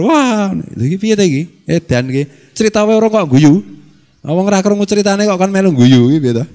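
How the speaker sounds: exasperated kiai. Acted. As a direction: complain in disbelief at the silly request. Kiai voiced complaining about forced laughter.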